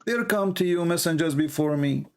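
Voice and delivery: normal talking voice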